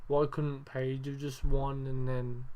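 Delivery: normal talking voice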